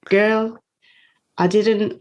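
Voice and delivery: imitating her Guyanese mum calling her girl; Guyanese accent. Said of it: "I can't do the accent".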